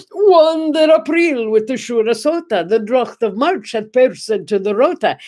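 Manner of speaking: put-on reciting Chaucer in Middle English, old vowels rolled; "Wan der April with the Shura Sota" in rolled Middle English sounds; Middle English recital. To staff at the lunch table, reciting Chaucer in Middle English. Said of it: "I performed for my staff the entire prologue in Middle English".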